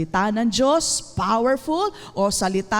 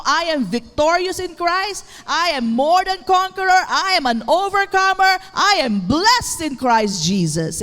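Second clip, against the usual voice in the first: higher-pitched, punched faith confession; emphatic declaration; 5 semitones higher, 4 dB louder than usual. Shown as a demonstration. Preacher models a faith confession for the congregation, emphatic declaration. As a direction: declare it with rising conviction.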